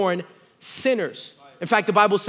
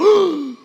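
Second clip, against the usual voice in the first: excited gasp; 9 semitones higher, 6 dB louder than usual. Retold or imitated. Son sees candy wall.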